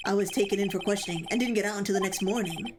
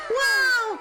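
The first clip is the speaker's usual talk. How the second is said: much higher, loud amazed wow; a big delighted gasp of a wow; surprised wow; 13 semitones higher, 6 dB louder than usual. Real, not acted. Streamer amazed by a raider's name, loud surprised wow.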